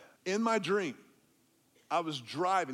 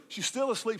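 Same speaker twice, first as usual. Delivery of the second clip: ordinary talk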